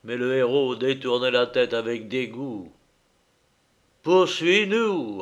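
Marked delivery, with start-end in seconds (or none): 4.0-5.2 higher, drawn-out order; commanding. A narrator's reading. Ulysse ordering the cyclope to follow, commanding. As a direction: call the order out slowly. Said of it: "ordonna Ulysse".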